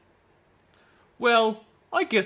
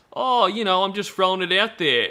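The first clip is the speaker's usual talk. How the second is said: pitched up, louder, faux-innocent shrug; innocent mock deflection; 6 semitones higher, 4 dB louder than usual. Mocking a groomer brushing off his bait, faux-innocent. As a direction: play it off all innocent and breezy. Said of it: "they do it in a way that".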